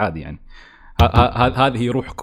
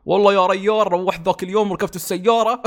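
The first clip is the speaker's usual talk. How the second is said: lightly exaggerated Emirati Al Ain accent, raised pitch; mock own dialect; 8 semitones higher than usual. Mocking their own Al Ain dialect, light Emirati accent. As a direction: poke fun at your own Emirati dialect. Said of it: "نستهبل على لهجتنا نحن".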